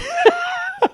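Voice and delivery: high squealing laugh; burst of high laughter. Real, not acted. Telling his friend the twist, laughing out high. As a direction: break into a high-pitched laugh.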